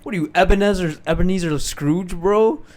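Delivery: high, loud mocking disbelief; incredulous. Real, not acted. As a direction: scoff at a ridiculous idea. Mocking friend's food choice.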